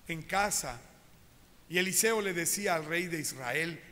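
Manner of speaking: ordinary talk, nothing marked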